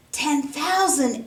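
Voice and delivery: slow, gasping crowd astonishment; a shocked crowd echoing the sum; astonished exclaim. A narrator's reading. Crowd gasps at the debt, slow and astonished. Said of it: "exclaimed everyone in the crowd".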